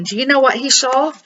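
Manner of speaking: ordinary delivery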